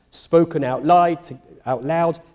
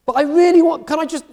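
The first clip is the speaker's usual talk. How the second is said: high, louder pleading; begging Abraham; 11 semitones higher, 4 dB louder, faster than usual. Acted. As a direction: push the plea up high and loud. Abraham still begging God.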